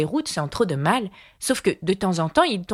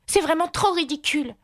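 put-on higher, exasperated little girl; losing patience; 11 semitones higher than usual. A narrator's reading. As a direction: cry out in mild exasperation. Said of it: "perdant patience". (fillette qui perd patience, voix aiguë)

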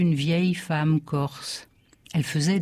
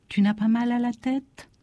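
high-pitched, gentle motherly question; worried mother; 6 semitones higher than usual. A narrator's reading. Mother feeling her child's forehead, worried. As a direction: ask anxiously in a higher voice. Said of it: "Ma mère ne fut pas convaincue".

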